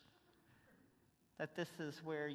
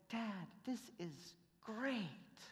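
put-on slow, higher, softer child's voice; little girl; 5 semitones higher, 5 dB quieter, much slower than usual. [name] quoting Tiffany in their new home, slow and higher. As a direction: savor it like a happy little girl. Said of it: "she just said".

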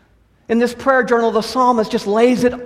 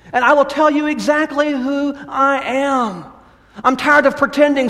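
fervent prayer; 6 semitones higher than usual. Acted. Preacher prays the psalm aloud.

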